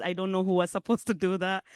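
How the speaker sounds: ordinary talk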